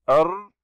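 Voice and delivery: "ر" as a held, doubled R; shadda sounded first. Shown as a demonstration. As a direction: hold the R in "ر" with no vowel after it. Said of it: "ننطق الشدة أولا". («ر» مشددة قبل الحركة)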